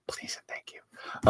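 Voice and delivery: breathy whispered plea, slow; whispered please. Real, not acted. Host whispering a plea to buy the comic, slow whisper. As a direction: whisper it like a sweet little favor. Pleading plug for friend's comic.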